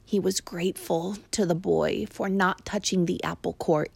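ordinary delivery, nothing marked

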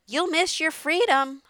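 high, loud goose warning; honking goose. A narrator's reading. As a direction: honk it out as a warning. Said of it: "honked the goose".

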